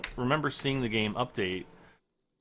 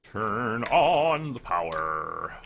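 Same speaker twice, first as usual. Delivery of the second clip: put-on old speech synthesizer; much slower than usual.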